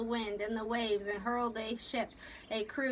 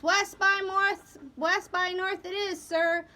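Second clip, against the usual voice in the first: helmsman's answer; 8 semitones higher, 5 dB louder than usual. A narrator's reading. Helmsman steadied on course, calling it back. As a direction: sing back the heading. Helmsman repeats order aloud.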